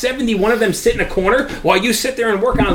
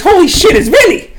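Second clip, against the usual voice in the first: surprised; 11 semitones higher, 4 dB louder than usual. Retold or imitated. Surprise recognizing friend.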